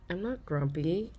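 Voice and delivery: ordinary delivery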